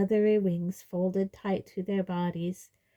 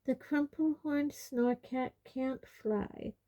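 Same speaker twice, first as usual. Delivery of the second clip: slow, soft, high-pitched dignity; dignified; 6 semitones higher, 6 dB quieter, slower than usual. A narrator's reading. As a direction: correct them slowly, with quiet dignity. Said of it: "in a dignified voice".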